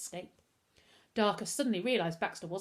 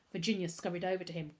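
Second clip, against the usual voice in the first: plain reading voice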